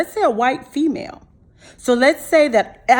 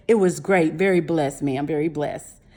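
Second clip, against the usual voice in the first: put-on sweet, humble young man; 9 semitones lower, 5 dB quieter than usual. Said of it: "He said".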